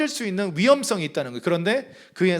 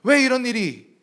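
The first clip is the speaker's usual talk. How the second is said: rising, louder protest of "왜"; protesting prophet; 5 semitones higher, 4 dB louder, slower than usual. Acted. Habakkuk protesting to God, higher and louder. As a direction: cry out why at God. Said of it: "항의하는 선지자의 모습입니다".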